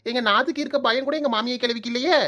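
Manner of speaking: normal talking voice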